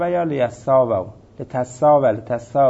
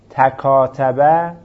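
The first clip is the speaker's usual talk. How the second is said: slow, careful demo form; 5 dB louder, much slower than usual. Shown as a demonstration.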